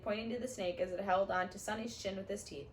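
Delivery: plain reading voice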